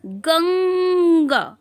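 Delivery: गंगा said very slowly, stretching the nasal अंग sound; drawn-out Ganga. Shown as a demonstration. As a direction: hold out गंगा so the ङ hum is clearly heard. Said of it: "ये है".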